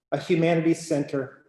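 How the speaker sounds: ordinary talk, nothing marked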